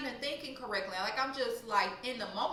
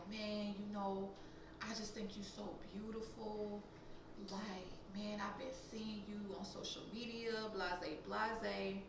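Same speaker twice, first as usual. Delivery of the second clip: imitated sweet-talker; 2 semitones lower, 10 dB quieter, slower than usual. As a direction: mimic a man softly laying on compliments. Imitates the stranger sweet-talking her.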